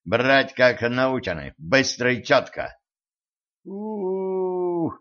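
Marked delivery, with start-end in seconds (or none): 3.6-4.9 long high letdown moan; disappointed drawl. A narrator's reading. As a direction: stretch it out, disappointed. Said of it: "разочарованно протянула спецкоманда".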